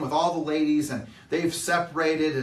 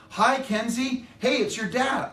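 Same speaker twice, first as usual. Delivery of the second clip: higher, bright playful greeting; cheery dad; 8 semitones higher than usual. Retold or imitated. Dad greeting his grumpy teen daughter, higher and cheery. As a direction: break the ice with a sunny hello. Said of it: "I would be like".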